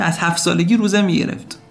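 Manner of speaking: ordinary delivery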